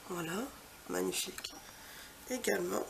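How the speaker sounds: ordinary talk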